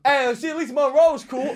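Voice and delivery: high, loud mocking jab; teasing voice. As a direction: say it like a cheeky jab. Comic mocks man mid handshake.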